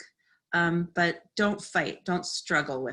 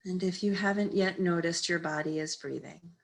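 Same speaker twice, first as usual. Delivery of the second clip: calm, unhurried meditation guide with long pauses; guided meditation. Real, not acted. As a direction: ease them into stillness, phrase by phrase. Leads a seated meditation.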